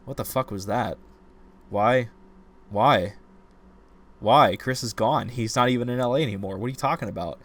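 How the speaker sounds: mock-indignant rant building to a yell; exasperated outburst. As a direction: throw up your hands at the book. Retold outraged reaction to ending.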